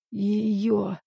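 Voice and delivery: weak, hoarse, drained of strength; bloody mouth. A narrator's reading. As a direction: gasp it out through a bloody mouth. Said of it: "Он обессиленно кивает".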